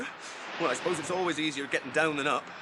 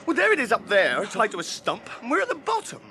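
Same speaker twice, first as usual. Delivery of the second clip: dismayed outburst; 6 semitones higher, 4 dB louder than usual. Acted. Sam dismayed at rope above.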